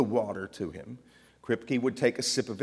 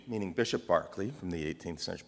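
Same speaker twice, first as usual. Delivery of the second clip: ordinary talk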